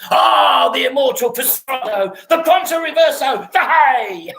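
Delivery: high, rising, excited mock calls; mock fencing cries. Friend mocking a duelist's showy fencing terms, high mock cries. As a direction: crow the fencing terms like a show-off.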